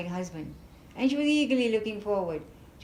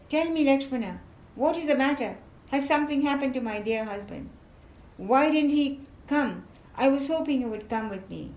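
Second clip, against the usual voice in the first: higher queen's voice, anxious and quick; urgent worried questioning; lightly voiced distressed queen; Sita, alarmed; 7 semitones higher, 4 dB louder, faster than usual. A narrator's reading.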